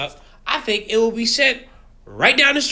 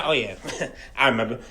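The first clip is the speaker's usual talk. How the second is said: low soft chuckle before the words; laughing; 10 semitones lower, 7 dB quieter, faster than usual. Real, not acted. Host chuckling while mocking Trump, soft laugh. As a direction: laugh it off with a quiet scoff.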